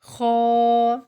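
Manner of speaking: خو said loud from a full mouth, throaty; full-mouthed kha. Shown as a demonstration. Tajweed teacher showing heavy خو to pupils. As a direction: fill the mouth and push out خو. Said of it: "منہ بھر کے ادا کریں گے".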